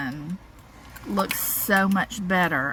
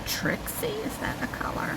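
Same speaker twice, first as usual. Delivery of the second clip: soft unvoiced whisper; 6 dB quieter than usual. Real, not acted. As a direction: whisper the question aside. Quiet aside off mic.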